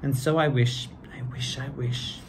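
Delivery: lively storybook read-aloud; reading to children. A narrator's reading. Two hosts reading Seuss to kids, lively storybook voice. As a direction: read it like a bedtime picture book.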